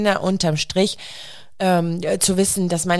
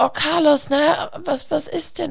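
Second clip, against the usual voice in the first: worried mother; 7 semitones higher than usual. Retold or imitated. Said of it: "Ich sag".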